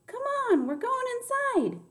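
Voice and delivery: high holler over the rain; urgent carrying shout. A narrator's reading. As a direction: call them in, loud and very high. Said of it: "I hollered at him over the rain".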